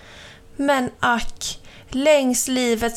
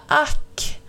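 a breathy sighed exclamation; suckande; 5 semitones higher than usual. A narrator's reading. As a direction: sigh it out, higher than usual. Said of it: "suckade han".

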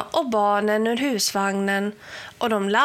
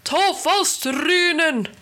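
high, slow roared command; 8 semitones higher, 3 dB louder than usual. A narrator's reading. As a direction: roar the order at your henchmen. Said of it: "vrålade Helgerån".

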